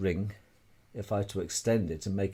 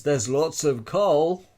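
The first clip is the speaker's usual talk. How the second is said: slow, louder, higher mock quote; mocking Admiral Byrd; 5 semitones higher, 5 dB louder, slower than usual. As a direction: drag it out like a dismissive official. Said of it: "All we get out of Admiral Byrd".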